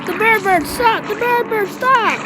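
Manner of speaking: cornered and screaming for help; panicked pleading. Pretend. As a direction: beg the bird to stop in panic. Game character panics, begs.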